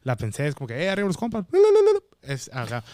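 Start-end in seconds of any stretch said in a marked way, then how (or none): 0.8-2.3 loud yell into a squeaky high bla bla; catchphrase yell on request. Shown as a demonstration. Doing his viral catchphrase when the host asks. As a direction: belt it out like a battle cry. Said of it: "A ver, hazla, hazla".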